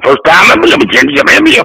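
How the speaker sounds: garbled Creole-Southern accent imitation; strange Southern accent. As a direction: gabble in a mock muddled Southern accent. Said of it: "this guy had the strangest accent".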